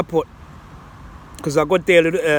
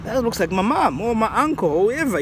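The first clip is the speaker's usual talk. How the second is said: surprised recognition; 6 semitones higher than usual. Retold or imitated. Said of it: "you're going".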